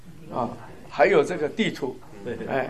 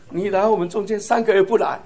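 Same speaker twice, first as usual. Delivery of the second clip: normal talking voice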